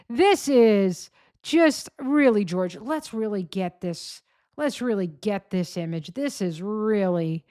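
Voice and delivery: ordinary talk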